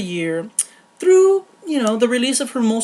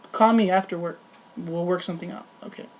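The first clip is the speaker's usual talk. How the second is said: lower, softer, furtive aside; mock secretive aside; 3 semitones lower, 6 dB quieter, faster than usual. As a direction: drop the act and quietly give in. Acted aside, secretly relenting to boss.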